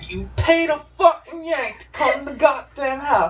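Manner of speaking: outraged shout. Acted. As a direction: shout it in scandalised disbelief. Outraged at paying a Yank.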